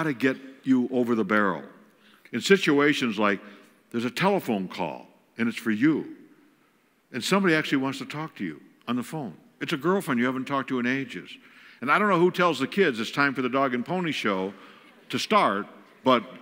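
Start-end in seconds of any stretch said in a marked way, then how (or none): none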